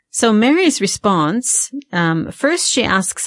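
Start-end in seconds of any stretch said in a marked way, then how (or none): none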